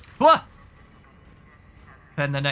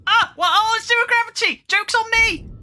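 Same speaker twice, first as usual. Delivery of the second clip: high, loud, startled laugh line; excited yelp; 7 dB louder than usual. Real, not acted. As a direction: yelp it as gravity cuts out. Floating in zero gravity.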